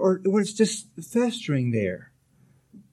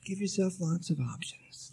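softer, quiet repeat; soft echo; 10 dB quieter than usual. Real, not acted. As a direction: let the repeat fall gently.

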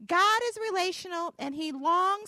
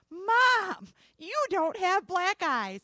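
raised, louder call, then drawn out; amazed exclamation; 4 semitones higher, 5 dB louder, much slower than usual. Retold or imitated. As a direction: retell a son's astonished cry, slowing after "Mom". Son's astonished discovery, retold.

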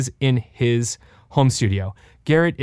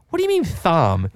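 baffled, higher put-on voice; incredulous mock question; 5 semitones higher than usual. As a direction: act stunned by the name. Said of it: "And I'm like".

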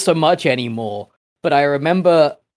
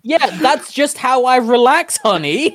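laughing excuse; 8 semitones higher than usual. Pretend. Mock excuse to honey.

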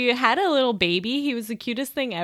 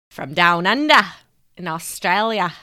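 loud, put-on Aussie accent; Australian accent; 9 dB louder than usual. Co-host shows off for listeners down under, Australian accent. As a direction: lay on a hammy Australian accent. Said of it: "I can do an Australian accent".

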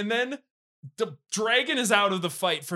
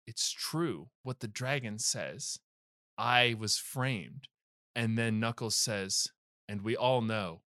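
hushed, slow, solemn retelling; low and soft, as if overcome by the story; mock-reverent, choked-up storytelling; emotional; 7 semitones lower, 6 dB quieter than usual. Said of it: "I get emotional".

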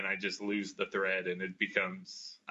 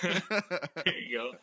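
laughing; 7 semitones higher, much slower than usual. Real, not acted. Playful reply after refusing.

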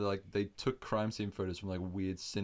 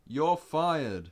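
loud, raised pronouncement; mock firing; 6 semitones higher, 8 dB louder than usual. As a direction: fire him in a hammed-up yell. Acting out Frankie firing agent.

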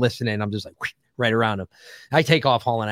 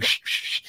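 whoosh imitation; 5 dB quieter than usual. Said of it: "I was just like".

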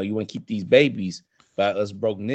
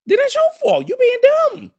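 much higher and louder, hammed-up indignation; high comic yell; 9 dB louder than usual. Real, not acted. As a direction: yell it up high like an outraged rant. Ranting about pregnant partner.

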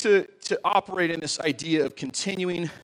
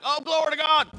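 higher-pitched mock TV preacher cry; 8 semitones higher, 3 dB louder than usual. Pastor mimicking a faith healer on TV, preacher voice. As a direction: exclaim like a showy televangelist. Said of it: "somebody on T V can be like".

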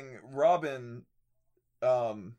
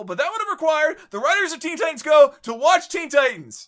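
loud rant; 14 dB louder than usual. Real, not acted. Ranting about the writers.

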